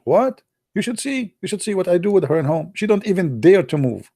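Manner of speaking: high, boastful bragging voice; lightly voiced cocky friend.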